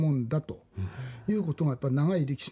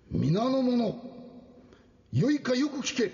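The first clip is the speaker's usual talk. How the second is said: high, booming feudal lord proclamation; Lord Maeda Toshiie; 8 semitones higher than usual. Acted. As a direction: address the crowd like a samurai lord. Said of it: "前田利家公になったつもりで言ってみたい".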